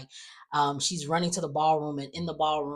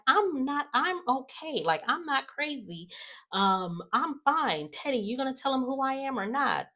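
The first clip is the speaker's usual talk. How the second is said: high-pitched mock insistence; imitated indignant protest; 9 semitones higher than usual. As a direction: protest high, mimicking her defensiveness. Retold protest of being called crazy.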